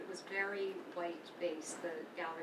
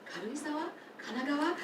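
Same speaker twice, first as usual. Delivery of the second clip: higher, puzzled guessing of place names; quizzing vendor; 8 semitones higher, 6 dB louder than usual. Retold or imitated. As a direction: mimic the vendor guessing hopefully. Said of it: "he's, like, giving me all these ka words".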